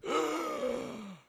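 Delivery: loud, high, drawn-out gasp for air; gasping back to life, acted out; imitated revival gasp. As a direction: suck in air loudly, like coming back to life. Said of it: "the guy goes".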